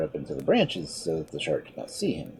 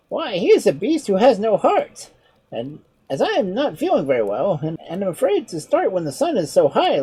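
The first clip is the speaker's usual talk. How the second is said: higher, lighter voice for the sly monkey; clever monkey; 13 semitones higher, 5 dB louder than usual. Acted. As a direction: play the monkey, a touch mocking. Monkey teases.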